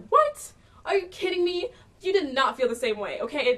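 high, loud shocked outburst; outraged disbelief. Retold or imitated. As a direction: shriek it in stunned disbelief. Outraged reaction to his reply.

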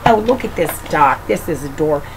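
ordinary delivery, nothing marked

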